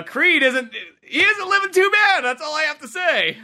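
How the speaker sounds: loud, high-pitched, worked-up rant; mock impassioned protest. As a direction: build to a high, indignant finish. Acted rant of a rooting viewer.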